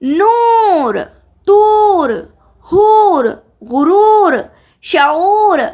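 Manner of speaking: नूर, तूर, हूर drawn out, high and loud; stretched long u. Shown as a demonstration. Teacher drilling the long vowel, words stretched out high. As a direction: sing out each long ऊ fully. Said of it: "उल्टे पेश के साथ खींच कर पढ़ा जाए".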